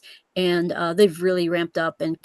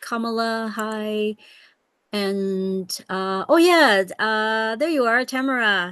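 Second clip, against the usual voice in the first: ordinary delivery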